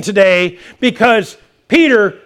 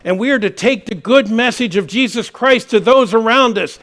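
normal talking voice

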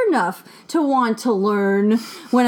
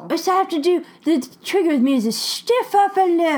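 mild put-on British accent, higher; 6 semitones higher than usual. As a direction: tease them with a jaunty English lilt. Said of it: "You love my British accent".

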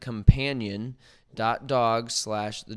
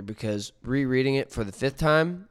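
ordinary talk, nothing marked

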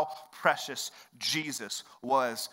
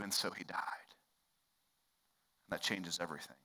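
whispered, much softer; hushed; 9 semitones lower, 14 dB quieter, faster than usual. Acted. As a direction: drop to a near whisper. Hushed key point.

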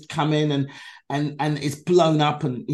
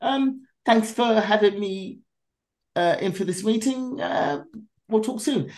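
sweetly hide the anger underneath; mock calm; 7 semitones higher than usual. Feigning calm to the producers as she leaves. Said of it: "I play very calm in the meeting".

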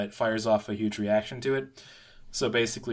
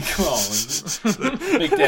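catcalling; 8 semitones higher, 7 dB louder, much slower than usual. Pretend. Mocking a catcaller.

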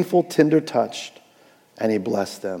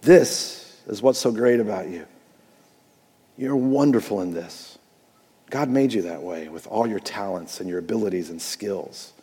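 soft, lower, gentle affirming voice; tender encouragement; 6 semitones lower, 6 dB quieter than usual. Retold or imitated. Pastor models a parent gently affirming their child, soft and tender. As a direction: speak a loving blessing over your child. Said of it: "speak over them godly encouragement".

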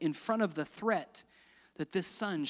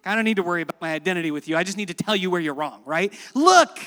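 resentful son; 7 dB louder, faster than usual. Acted. Pastor voices resentful son.

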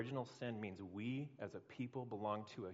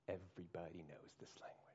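hushed unvoiced repeat; whisper; 5 semitones lower, 10 dB quieter than usual. Shown as a demonstration. Preacher whispering the line to the crowd. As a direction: breathe it like a secret. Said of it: "repeated line".

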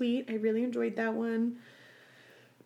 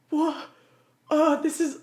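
confused; 6 semitones higher, 3 dB louder than usual. Retold or imitated. Retold confused reaction to ending.

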